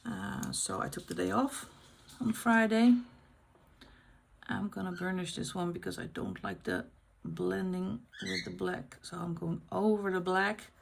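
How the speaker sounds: usual speaking voice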